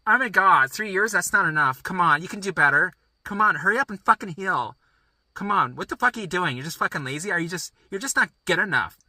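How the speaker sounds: high, loud scolding rant; impatient yelling at a lazy body; berating shout. Pretend. Scolding own body for healing slowly.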